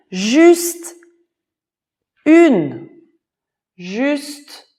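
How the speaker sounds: high, loud shout; shouted plea. Acted. Master shouts after the fleeing guest, high and loud. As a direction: call after the runner. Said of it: "il a crié".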